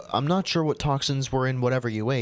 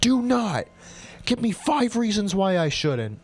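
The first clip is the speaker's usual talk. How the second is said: frantic shout; 8 semitones higher than usual. Acted. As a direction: scream it to save your mother. Daughter pleads for mother.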